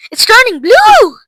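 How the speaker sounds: high, loud panicked cry; horrified scream. A narrator's reading. Mother watching Violet's nose change, screaming. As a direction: scream it in alarm. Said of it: "screamed Missus Beauregard".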